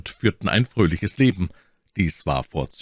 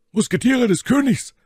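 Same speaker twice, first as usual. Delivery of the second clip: Athos answering; 4 dB louder than usual. A narrator's reading.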